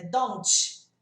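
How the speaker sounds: "don't" ending in a loud CH, like "donch"; added final sound. Shown as a demonstration. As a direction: hit the end of "don't" as a strong CH. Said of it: "as pessoas colocam t também".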